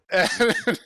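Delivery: ordinary delivery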